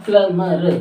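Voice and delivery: प्लमर broken into pla-ma-r; silent-b plumber. Shown as a demonstration. Teacher drills learners, प्लमर in separate sounds without b. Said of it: "इसका प्लम्बर नहीं".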